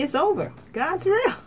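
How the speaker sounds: high exultant declaration. Real, not acted. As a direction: proclaim it with joy. Conviction climax.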